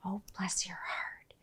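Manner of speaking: whispered, slow and sugary; slow sarcastic whisper. Shown as a demonstration. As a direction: whisper it sweetly with a sting. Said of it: "It's like when you say".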